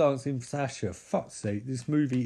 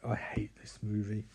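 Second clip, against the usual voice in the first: whispered and softer; 5 semitones lower, 9 dB quieter than usual. Real, not acted. Fed up with the movie, telling viewers in a whisper. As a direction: breathe it out under your breath in disgust.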